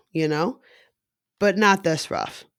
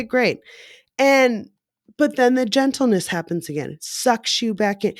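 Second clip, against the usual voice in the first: ordinary talk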